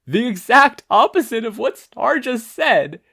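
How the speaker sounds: scream. A narrator's reading. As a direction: shout under a heavy load. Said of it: "Izuku screamed".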